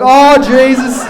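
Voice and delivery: loud, high, drawn-out cry; mortified exclamation. Retold or imitated. Dad reacting to his son's question, loud dismay. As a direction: groan it out loudly, utterly mortified.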